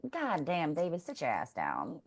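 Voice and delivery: mock inner thought. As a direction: voice Star's silent exasperation, barely audible. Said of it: "in her mind thinking".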